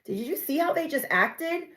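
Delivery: very high, disbelieving; can you believe it, pitch soaring; shocked outsider. Retold or imitated. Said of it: "that extra set of eyes to tell you".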